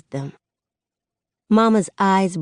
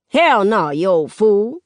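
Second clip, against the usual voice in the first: loud, higher-pitched grandmotherly snub; scornful retort; 4 semitones higher, 5 dB louder than usual. Acted. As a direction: holler a dismissal at him. Nana snubs the old man loudly.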